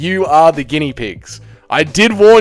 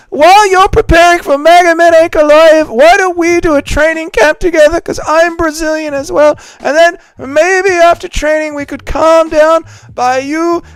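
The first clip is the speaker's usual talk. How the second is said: much higher, louder mocking voice of a fighter begging; squeaky pleading caricature, pitched way up; Ian Garry impression; 14 semitones higher, 5 dB louder than usual. Streamer mocks a fighter begging a rival on chat's request, high pleading voice. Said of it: "do an impression of Ian Garry begging Pereira".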